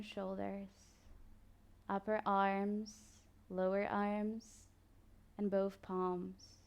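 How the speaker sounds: soft, slow body-scan guidance with long pauses; guided meditation. Acted. Yoga teacher guiding listeners through a body scan, calm meditation voice. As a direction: ease the listener into stillness, unhurried.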